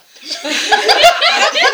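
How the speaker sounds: loud high laughter. Real, not acted.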